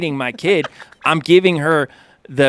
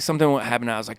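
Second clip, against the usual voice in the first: ordinary talk, nothing marked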